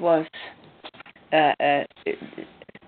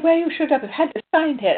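imitating an angry man, much higher and louder; mock-indignant high, loud complaint; aggressive retort; 11 semitones higher, 5 dB louder, faster than usual. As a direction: replay his heated protest to the police. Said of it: "And he was so aggressive".

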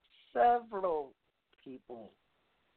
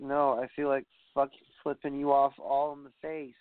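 ordinary talk, nothing marked

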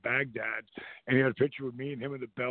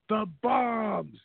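higher, slow and frantic; freaked-out buddy; 8 semitones higher, 3 dB louder, much slower than usual. Retold or imitated. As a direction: drag out the bombs in mock panic. Panicked Rob about the bombs.

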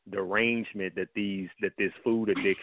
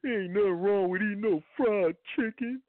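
much higher, tearful-sounding whine mocking a commenter; imitating whiny near-crying; 12 semitones higher than usual. Mocking an upset commenter who defends fried chicken, whiny near-tears voice. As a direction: whimper it like a grown man about to cry. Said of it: "he was saying it like he was gonna cry".

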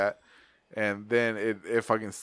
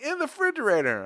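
much higher, teasing; mock family voice; 12 semitones higher than usual. Family pointing out the fridge doll, high teasing. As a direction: say it like it's obvious. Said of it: "They're like".

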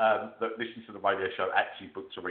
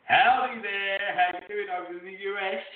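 high, hammed-up American howdy; exaggerated American greeting from a Brit; mock American accent; 10 semitones higher than usual. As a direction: put on a playful American twang, pitched up high. British guest greets American host, "Howdy.

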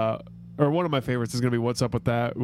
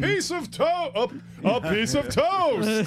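quoting a sketch; 9 semitones higher, slower than usual. Retold or imitated.

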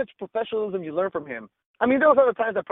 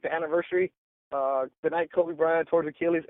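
ordinary talk

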